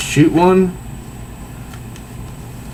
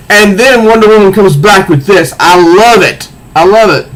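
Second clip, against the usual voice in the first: eager, raised voice of a fan; excited; 6 semitones higher, 4 dB louder than usual. Real, not acted. Fan gushing to viewers, excited and raised. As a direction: gush about the comic, a bit higher.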